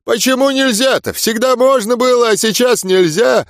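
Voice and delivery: put-on high, slurred, cocky drunk; drunken slur. A narrator's reading. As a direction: push back like a tipsy brawler. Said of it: "задиристо выпячивая грудь".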